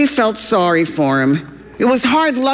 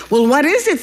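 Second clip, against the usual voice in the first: bursting with excitement; 8 semitones higher, faster than usual. Acted. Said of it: "nearly bursting with excitement".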